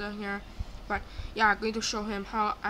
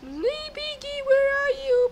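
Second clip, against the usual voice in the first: very high singsong calling; teasing high call; 6 dB louder, slower than usual. Pretend. Player searching for Piggy, teasing high call. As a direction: call out in a held high squeal.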